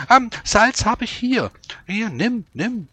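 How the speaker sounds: put-on high, eager old woman. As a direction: play the old woman handing over salt. Old woman offers salt.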